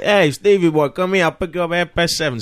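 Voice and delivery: loud, high American driver voice; American driver accent. Retold or imitated. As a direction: call out like a Chicago driver. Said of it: "when you go to another country".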